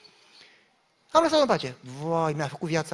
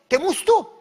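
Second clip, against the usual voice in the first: defiant retort; 11 semitones higher, 8 dB louder than usual. Retold or imitated. Pastor's retort to her threat.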